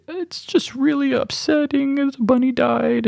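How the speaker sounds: high, slow, teary whine; imitating crying girlfriend. Retelling his upset girlfriend in bed after the bunny died, high teary imitation. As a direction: mimic her weepy complaint, drawn out and high. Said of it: "and then she started crying".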